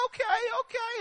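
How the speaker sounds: small, high, quiet voice; small son's voice. Retold or imitated. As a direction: imitate a timid child agreeing. Voicing the small son's answer.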